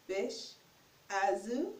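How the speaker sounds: usual speaking voice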